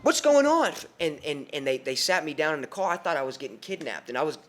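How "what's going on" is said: loud, high-pitched acted panic; alarmed shout. Teen being grabbed from the car, acted alarmed yelling. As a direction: cry out as if suddenly grabbed.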